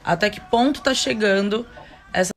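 usual speaking voice

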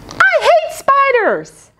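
high, slow panicked shriek; shrieking child. Acted. Child shrieking at the spider in the room, high and slow. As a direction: shriek it out in terror.

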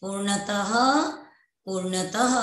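पूर्णतः with an audible final "ha"; visarga drill. Shown as a demonstration. Teacher drills last example, पूर्णतः ending in "ha". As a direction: end पूर्णतः on a breathed "h", pause, repeat. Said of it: "आ रही है ना अतः की ध्वनि?".

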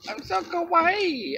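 high, slow cutesy kawaii squeal; excited anime fan. Pretend. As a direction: gush like a giddy anime girl.

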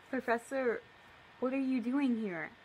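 ordinary talk, nothing marked

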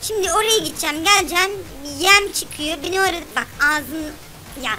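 ordinary talk

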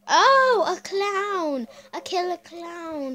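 sudden high, loud cry of fright; startled shriek. Real, not acted. Seeing a killer clown in the ad, a frightened shriek.

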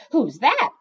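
high, loud, nosy question; curious cat. A narrator's reading. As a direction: pipe up eagerly as a curious cat. Same curious cat asks.

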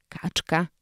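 plain reading voice

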